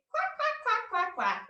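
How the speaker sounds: a cartoon duck's quack. Shown as a demonstration. Yoga teacher answering what a duck says, with a high quack. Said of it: "What does a duck say?".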